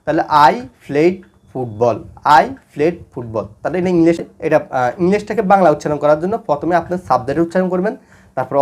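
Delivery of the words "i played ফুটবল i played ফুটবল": spaced out for students to write down; slow dictation. Shown as a demonstration. Teacher giving students the English translation, slow dictation. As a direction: say it slowly, word by word.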